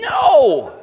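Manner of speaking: loud, sharp shouted no with a laugh; emphatic no. Real, not acted. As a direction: slam the idea down. Preacher rejecting the clown idea.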